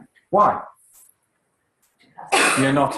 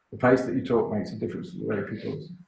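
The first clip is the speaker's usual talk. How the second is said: rushed and softer, low in pitch; too fast; 3 semitones lower, 6 dB quieter, faster than usual. Shown as a demonstration. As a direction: race through it quietly with no contrast. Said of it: "Do not speak too fast".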